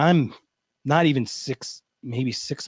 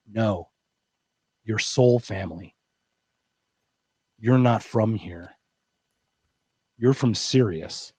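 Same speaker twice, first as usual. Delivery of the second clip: slow, lower, dead-serious prophecy; deadpan mystic; 5 semitones lower, slower than usual. Retold or imitated. Healer telling the client he is from Sirius, deadpan and slow. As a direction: deliver the cosmic news totally straight-faced. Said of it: "He looks up at me deadpan".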